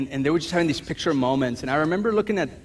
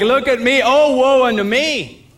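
higher, slow hammed-up lament; mocking a pity mentality, higher and slow; mock woe; 7 semitones higher, 5 dB louder, slower than usual. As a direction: play the martyr for laughs.

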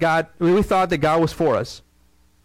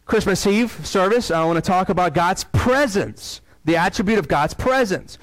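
ordinary talk

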